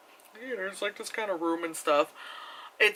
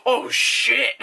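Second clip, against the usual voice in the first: mock audience gasp; 5 semitones higher, 4 dB louder than usual. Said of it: "people in the theater were like".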